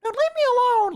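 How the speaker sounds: very high, squeaky whine; mock squealing child voice; ten-year-old kid. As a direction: plead like a picked-on little kid. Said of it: "like, a ten-year-old that was like".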